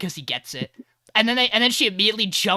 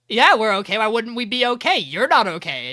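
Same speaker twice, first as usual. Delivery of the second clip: loud, raised-pitch indignant retort; hammed-up defensive snapping back; defensive outburst; 5 semitones higher, 7 dB louder than usual. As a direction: fire it back loudly, mock indignant. Acted defensive reply in a quarrel.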